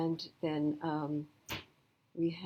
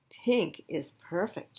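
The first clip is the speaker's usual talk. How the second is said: slow, assured; 4 dB louder, slower than usual. Acted. Classmate says it calmly.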